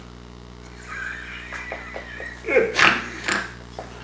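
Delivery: high airy burst of laughter; laughing at being a wuss; real laugh. Real, not acted. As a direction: laugh at yourself. Big laugh at own story.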